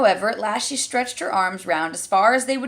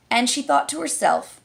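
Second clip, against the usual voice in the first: usual speaking voice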